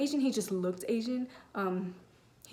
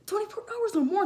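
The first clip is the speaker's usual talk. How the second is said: disbelief; 11 semitones higher, 5 dB louder than usual. Retold or imitated. Disbelief at labor estimate.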